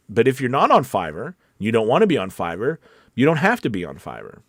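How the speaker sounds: normal talking voice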